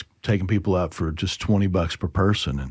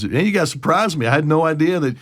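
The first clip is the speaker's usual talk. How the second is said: mock amazed pilot; 7 semitones higher, 3 dB louder than usual. Pilot amazed after landing.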